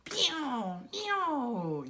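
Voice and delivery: bright high kitty meows; kitten puppet meowing. Pretend. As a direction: meow happily like a puppet cat.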